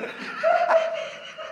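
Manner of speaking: high-pitched burst of laughter; laughing fit. Retold or imitated. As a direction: break into high laughter while telling it. Recuerda que se rió solo. (carcajada aguda al contar la anécdota)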